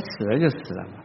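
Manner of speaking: retold low, quiet brush-off; low, indifferent reply in a retold exchange; dismissive retort. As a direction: shrug it off softly, as the unmoved husband. Acted unfeeling reply to crying wife.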